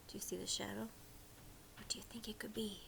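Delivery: suspenseful whisper. Real, not acted. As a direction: whisper as if the shadow might hear. Whispered questions to child.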